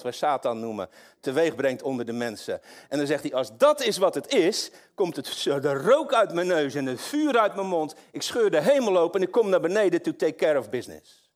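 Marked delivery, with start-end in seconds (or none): 3.3-11.3 higher and louder, fierce declaration; preacher voicing God coming down in fury; preaching God's voice. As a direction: declare it like God rising to act. Voices God's fiery resolve.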